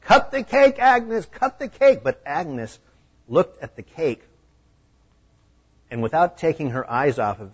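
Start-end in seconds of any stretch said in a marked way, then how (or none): 0.0-2.0 high, loud chant of the crowd; cheering yell. A narrator's reading. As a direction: shout it like a cheering party crowd. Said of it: "Everyone cheered and yelled".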